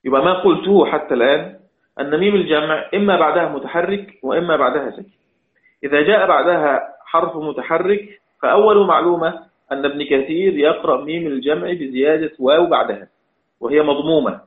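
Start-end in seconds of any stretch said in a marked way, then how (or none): none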